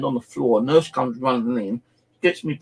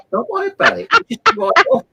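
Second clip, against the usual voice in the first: imitating the shop assistant; 6 dB louder than usual. Assistant voiced in retold story.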